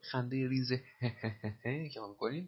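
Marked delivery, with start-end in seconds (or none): 0.8-1.9 giggle. Shown as a demonstration.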